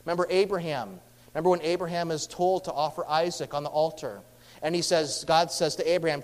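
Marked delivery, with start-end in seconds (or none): none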